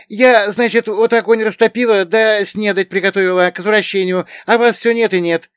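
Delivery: very high, loud housewife's voice; fussing young woman Afinka. A narrator's reading. (высокий, громкий голос хлопочущей хозяюшки)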